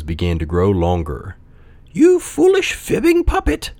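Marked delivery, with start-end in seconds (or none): 1.9-3.7 very high, scolding insect voice; buzzing cricket. A narrator's reading. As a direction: scold in a tiny high buzz. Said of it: "buzzed a voice".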